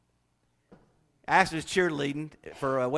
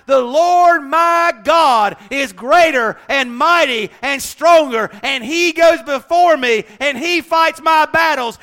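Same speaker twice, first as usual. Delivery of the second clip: higher, louder, slow fiery sermon; full-force preaching; 8 semitones higher, 12 dB louder, slower than usual. Acted. Preacher urging the church to speak faith: fiery shout. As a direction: preach it out with rising fire.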